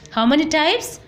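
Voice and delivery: usual speaking voice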